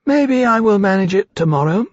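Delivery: high, thin little voice; thin-voiced young creature. A narrator's reading. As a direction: pipe it up hopefully in a high voice. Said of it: "said in his thin voice".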